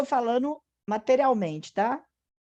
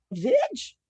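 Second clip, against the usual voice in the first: gentle authoritative command. Shown as a demonstration. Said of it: "docilidade do comando daquele que tem autoridade".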